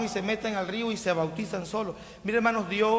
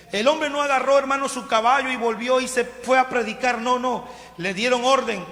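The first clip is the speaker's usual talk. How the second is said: fiery preaching; 4 semitones higher, 5 dB louder than usual. Acted. Preaching at full force.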